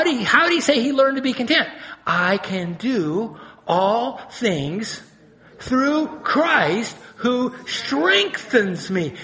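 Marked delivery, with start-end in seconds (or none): 2.1-9.1 slow, weighty stress on each phrase, "all things", "Christ"; emphatic declaration. Retold or imitated. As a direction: proclaim "I can do all things" word by word, drawn out. Preacher declares Paul's verse emphatically.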